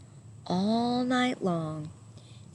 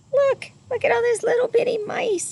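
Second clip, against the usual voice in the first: much higher, fast, thrilled; excited discovery; 6 dB louder, faster than usual. Real, not acted. Spotting mice in the picture.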